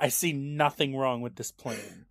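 slow, soft deadpan agreement; mock hushed approval. Girlfriend approving the absurd plan, slow and hushed. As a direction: say it softly and slowly, as the willing girlfriend.

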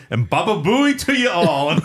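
high, loud Jamaican sign-off; Jamaican accent. Retold or imitated. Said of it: "in this Jamaican accent".

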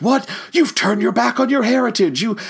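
high-pitched, indignant mock protest; imitating offended man. Ukrainian man confronts Paul Frees over denied heritage, mock indignant. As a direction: act out taking it personally, voice pushed way up. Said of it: "took it personally".

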